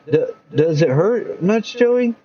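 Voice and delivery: hesitant, slow anxious question; hesitant worried mom; worried mother. Acted. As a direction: ask it fearing the answer.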